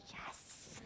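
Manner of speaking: a whispered, delighted "Yes.". Retold or imitated. Ezra getting good news, whispering with joy. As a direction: breathe a tiny excited yes. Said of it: "she'll go".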